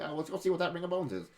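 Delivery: high, eager player chirp; a hint of a goofy adventurer. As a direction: pitch it way up like a reckless player.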